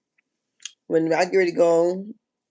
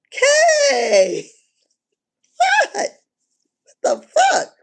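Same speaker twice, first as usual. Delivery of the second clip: much higher, louder outburst with a laugh; shocked disbelief; 5 dB louder, much slower than usual. Real, not acted. Vlogger reacting to the reunion gossip, high shocked shout. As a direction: shriek out the shock.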